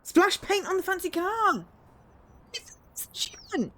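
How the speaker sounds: high, loud thrilled reading; excited shriek. Real, not acted. Unlocking an achievement and reading it out high and loud. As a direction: blurt the achievement out in delight.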